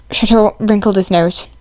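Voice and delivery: usual speaking voice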